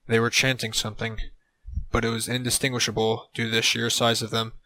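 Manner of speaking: ordinary delivery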